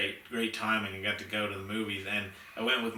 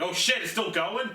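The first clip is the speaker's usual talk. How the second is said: higher and louder mock amazement; surprised reaction; 8 semitones higher, 4 dB louder than usual. Viewer realizing the movie isn't over yet, loud surprised exclamation. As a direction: act out delight that the movie keeps going. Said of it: "You're like".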